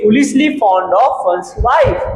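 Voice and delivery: each word stretched out for note-taking; slow dictation. Shown as a demonstration. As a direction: dictate it very slowly. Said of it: "teacher dictates English definition".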